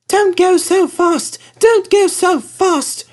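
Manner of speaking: very high, slow, complaining carriages; drawn-out grumble from tiny coaches; slow, squeaky coach chorus complaining; grumbling coaches. A narrator's reading. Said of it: "grumbled the coaches".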